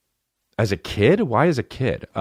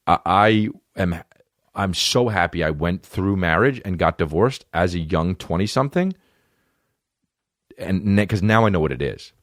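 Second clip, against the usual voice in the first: ordinary talk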